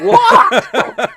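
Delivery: high startled laughing what. Retold or imitated. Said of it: "And I'm just like".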